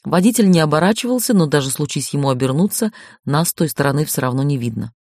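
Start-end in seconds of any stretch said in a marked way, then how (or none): none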